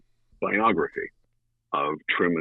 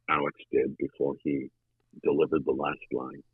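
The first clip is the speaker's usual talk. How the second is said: ordinary delivery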